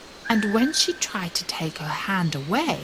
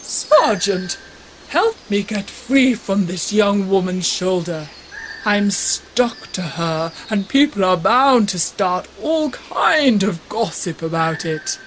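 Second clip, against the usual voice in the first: bishop's voice, louder and pleading; bishop calling out; 4 dB louder than usual. A narrator's reading. Bishop calls to the sergeant for help, louder and flustered. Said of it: "The bishop called out to him".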